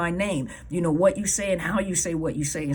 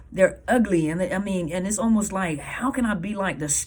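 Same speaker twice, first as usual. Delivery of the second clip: ordinary delivery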